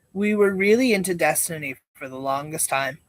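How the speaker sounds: ordinary talk, nothing marked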